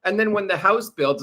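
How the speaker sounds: normal talking voice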